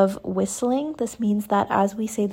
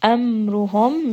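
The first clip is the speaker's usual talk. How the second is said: elongated; slower than usual. Shown as a demonstration. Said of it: "Pull this for four counts".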